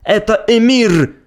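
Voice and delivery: high, slow excited cry; exclaiming. Acted. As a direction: call out the horse's name. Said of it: "воскликнул старый наездник".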